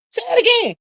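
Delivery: "Say it again" jumped way up high and squealed. Shown as a demonstration. Host egging on chat, repeating it in a high squeal.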